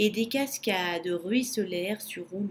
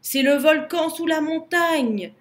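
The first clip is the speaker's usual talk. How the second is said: high, loud alarm; panicked moan; 6 semitones higher, 6 dB louder than usual. A narrator's reading. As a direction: wail it as a frightened baboon. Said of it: "gémit le babouin affolé".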